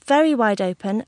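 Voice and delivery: usual speaking voice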